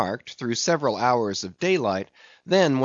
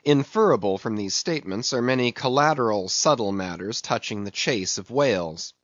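ordinary delivery, nothing marked

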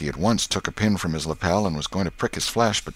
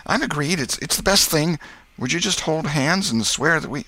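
much higher-pitched, eager lad; Tom's eager boy voice; 11 semitones higher, 4 dB louder than usual. Acted. Tom agrees to swear with Huck, eager high voice.

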